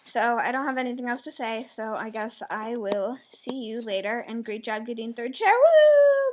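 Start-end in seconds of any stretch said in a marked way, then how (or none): 5.5-6.3 loud, high-pitched woo; celebratory whoop. Real, not acted. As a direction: let out a big, sustained whoop. Cheer closing the congratulations.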